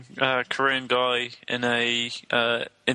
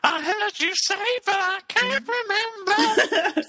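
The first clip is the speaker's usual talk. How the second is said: very high, slow robotic falsetto; sing-song auto-tuned robot voice. Pretend. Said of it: "Mister Gibbs".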